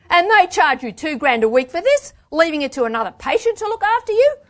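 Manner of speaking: very high, loud incredulous challenge; outraged. A narrator's reading. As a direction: climb in pitch with indignation. Outraged at clinic's fees.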